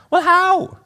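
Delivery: very high, affronted British lady; Pam. Acted. Mother hurt her son stayed away, high British accent. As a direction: complain in a high British woman's voice. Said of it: "do a little accent work".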